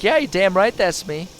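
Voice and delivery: loud, cocky, high brag; cocky yes that's me. Acted. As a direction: own it with swagger. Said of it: "be like".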